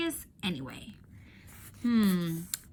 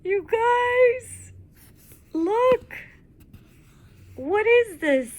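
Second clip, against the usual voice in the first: high, loud and thrilled, calling the class to look; excited; 14 semitones higher, 10 dB louder, much slower than usual. Real, not acted. Teacher calling her class to look, high and excited. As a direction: burst out with real excitement at the discovery.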